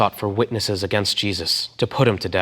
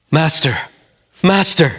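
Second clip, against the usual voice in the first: louder, higher "Master, Master", climbing; urgent calling out; 7 semitones higher, 7 dB louder than usual. Acted. Judas greeting Jesus with a kiss, calling out loud. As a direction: call out to him eagerly, the second higher.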